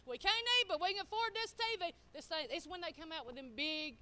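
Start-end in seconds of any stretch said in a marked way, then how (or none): 0.1-1.9 higher and louder, fast ironic brag; sarcastic pride in a foolish purchase; mock boast. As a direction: sell the silly logic with a proud grin. Mocking own past logic.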